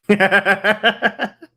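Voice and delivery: loud high burst of laughter. Real, not acted. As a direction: laugh hard at yourself. Laughing at own penis joke.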